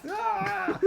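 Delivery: high playful scream; high-pitched "aah"; mock scream. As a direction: squeal in mock protest. Playful yelp at a finger near face.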